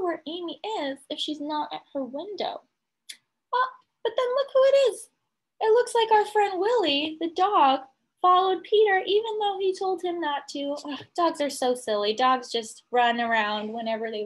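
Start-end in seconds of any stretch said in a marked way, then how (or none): 3.5-10.7 much higher, louder and quicker; big storyteller's gasp of discovery; excited reveal. Real, not acted. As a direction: burst out with delighted surprise. Surprise reveal to children.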